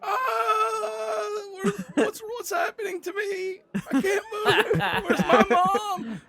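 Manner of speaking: put-on very high, wailing little boy; hammed-up helpless child's cry; screaming and crying. Frozen little boy wants his mom, high screaming wail. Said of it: "he starts screaming and crying".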